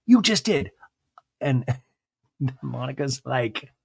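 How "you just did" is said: sharp retort. Retold or imitated. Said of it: "Monica retorts".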